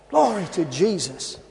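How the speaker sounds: ordinary talk, nothing marked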